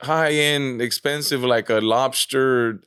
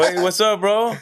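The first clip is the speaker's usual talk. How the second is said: higher-pitched, upbeat re-enacted greeting; friendly greeting; 9 semitones higher than usual. Greeting a fan in the sandwich shop, friendly and upbeat. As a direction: act out hailing a fan.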